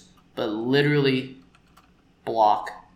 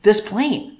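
higher, louder mock scolding; 7 semitones higher, 6 dB louder than usual. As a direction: tell him off with relish. Imagined scolding.

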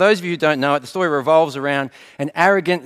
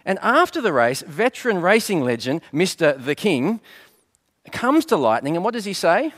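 ordinary talk

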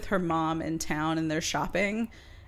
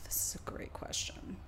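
whisper; 14 dB quieter than usual. Real, not acted. Sighs then answers.

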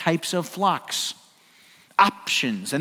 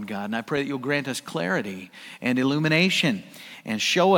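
softer, lower prayer voice; pastor's quiet, reverent opening prayer; 7 semitones lower, 5 dB quieter than usual. Real, not acted. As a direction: pray humbly over the congregation.

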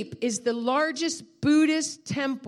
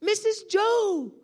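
long, high, loud call; imitated drawn-out yell; retold calling out; 10 semitones higher, 5 dB louder, slower than usual. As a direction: call to someone across the street. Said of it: "he, he yells out".

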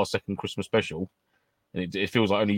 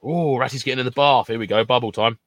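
ordinary talk, nothing marked

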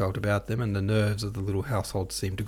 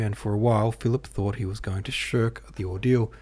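ordinary delivery, nothing marked

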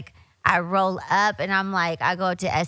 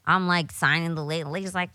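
ordinary talk

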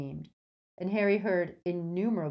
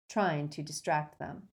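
plain reading voice

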